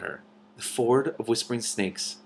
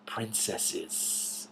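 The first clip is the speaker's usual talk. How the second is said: unvoiced hissing snake voice; soft hiss; 12 dB quieter than usual. A narrator's reading. Hidden snake warning the dragons, hissed whisper. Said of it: "hissed the voice from under the rock".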